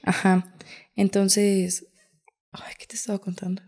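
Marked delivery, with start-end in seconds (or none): 2.5-3.6 a quick whisper, fast, searching for the story; storyteller forgetting where she was, whispered; whispered aside. Real, not acted. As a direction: whisper it to yourself, having lost the thread. Loses thread, aside to herself.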